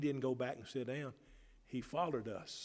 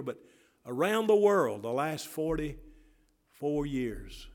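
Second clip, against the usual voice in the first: higher, louder preached call; preaching building up; 3 semitones higher, 6 dB louder than usual. Acted. Pastor stirring the church about souls saved, rising preaching. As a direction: build the fervor.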